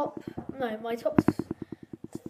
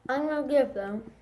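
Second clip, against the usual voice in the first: talking while chewing. Real, not acted. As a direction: hand at mouth. Seen 2: Hand held at lips, eating candy strands, mouth moving while speaking.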